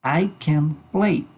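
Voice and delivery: slow, careful "I can play"; slow model sentence. Shown as a demonstration. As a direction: model it slowly for the class. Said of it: "Ojo con su pronunciación".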